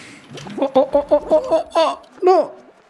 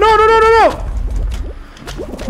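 panicked yelling; 10 semitones higher, much slower than usual. Real, not acted. Panicked as character gets caught.

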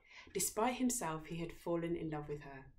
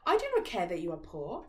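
louder, firm princess; declaring princess; 7 dB louder than usual. A narrator's reading. Princess declaring her love despite his poverty. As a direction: declare it boldly. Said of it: "declared the princess".

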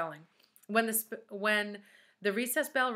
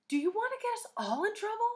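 anxious high voice. A narrator's reading. Allison pleading anxiously.

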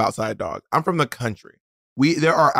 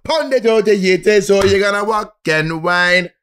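put-on chanting a soca song, Caribbean accent; 4 semitones higher than usual. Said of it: "your Caribbean accent".

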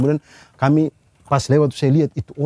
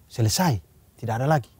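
usual speaking voice

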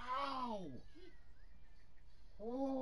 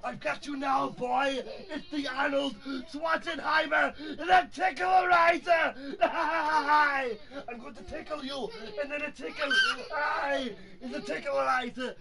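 higher, hammed-up action-hero taunting; excited play-fight voice, pitched up; playful tickle villain; 5 semitones higher than usual. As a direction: announce a tickle attack with mock menace. Playful tickle attack on young nephew.

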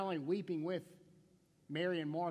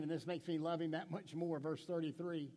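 usual speaking voice

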